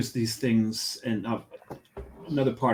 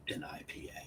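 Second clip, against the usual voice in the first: whisper; 21 dB quieter than usual. Retold or imitated. Teasing prediction to new coworker.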